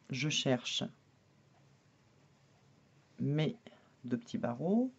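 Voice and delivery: slow, spaced robot voice; talking like a robot while concentrating. Real, not acted. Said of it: "quand je parle comme un robot comme ça".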